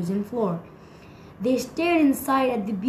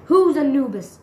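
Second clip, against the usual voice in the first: higher, louder sharp demand; 4 semitones higher, 7 dB louder than usual. A narrator's reading. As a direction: demand an answer at once. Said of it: "demanded Grimstone".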